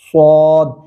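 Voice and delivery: स्वाद with heavy S and rounded vowel; emphatic, held "swaad" for स्वाद; rounded Saad. Shown as a demonstration. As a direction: round the lips through a long स्वाद. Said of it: "होंठों की शक्ल गोल हो जाती है".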